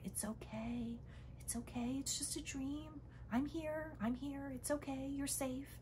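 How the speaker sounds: put-on soft, hushed reassurance to a frightened child; soothing whisper. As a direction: whisper it gently into their ear. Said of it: "whisper quietly in their ear".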